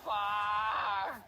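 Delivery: loud angry shout; frustrated shout. Real, not acted. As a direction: yell it out in anger. Frustrated outburst in game.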